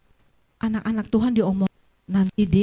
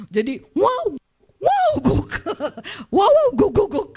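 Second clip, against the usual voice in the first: mock animal noises; 11 semitones higher, 4 dB louder, slower than usual. Dog and tiger trading noises.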